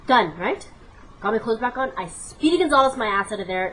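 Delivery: normal talking voice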